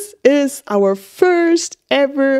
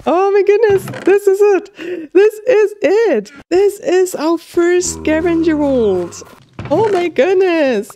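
thrilled; 6 semitones higher than usual. Real, not acted. Gamer completing the first scavenger vault, elated. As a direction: burst with joy at the win.

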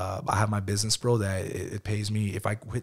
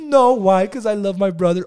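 put-on high and louder, a little kid's answer; mock kid voice, much higher; child voice; 10 semitones higher, 8 dB louder than usual. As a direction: sound like an earnest small boy. Son's voice in dialogue.

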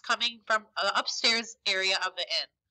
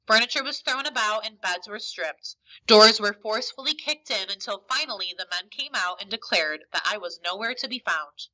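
plain reading voice

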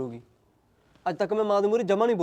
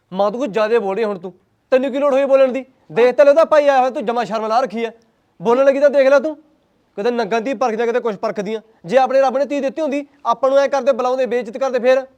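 loud, high, furious telling-off of his mother; angry shouting; 4 semitones higher, 9 dB louder than usual. Acted. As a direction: yell at her, fed up. Son shouts back at mother. (ਗੁੱਸੇ ਵਿੱਚ ਉੱਚੀ ਬੋਲਣਾ)

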